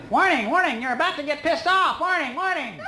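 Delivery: very high robotic warning voice, laughing at the end; mock alarm voice. Explaining anger to the audience as a mock alarm, high robotic alarm voice. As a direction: sound off like a silly alarm siren in your head. Mocks brain's internal alarm.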